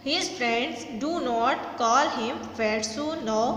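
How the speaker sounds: very slow, every word spaced out for the class; slow dictation read. Shown as a demonstration. Teacher reading a sentence to students, very slow and deliberate. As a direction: read it slowly, word by word, so students can follow. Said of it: "teacher reads the sentence aloud".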